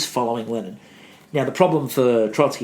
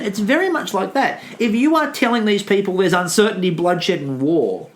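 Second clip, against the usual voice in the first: normal talking voice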